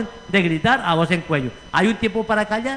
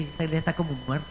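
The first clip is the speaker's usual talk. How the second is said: muttered remark; 5 semitones lower, 10 dB quieter, much faster than usual. Retold or imitated. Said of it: "uno entra y uno dice".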